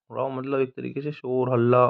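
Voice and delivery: usual speaking voice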